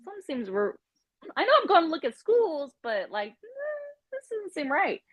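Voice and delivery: high, teary voice breaking on the words; upset. Retold or imitated. Teen telling her parents something feels wrong, voice cracking and teary. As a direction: replay the moment you almost cried, pitched high.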